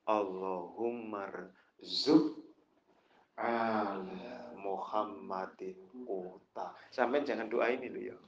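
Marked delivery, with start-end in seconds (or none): 0.1-6.7 low, slow chanted Arabic supplication; Arabic prayer recitation. Retold or imitated. Preacher reciting the Prophet's prayer to the congregation, slow low chant. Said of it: "tadi doanya Rasul".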